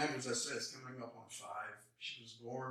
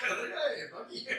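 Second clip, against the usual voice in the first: friends' greeting; 12 semitones higher, 8 dB louder, much slower than usual. Retold or imitated. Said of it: "Sees them".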